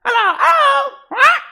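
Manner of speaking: very high, loud squawking parrot. Acted. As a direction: screech it like a parrot. Voicing the parrot Polly.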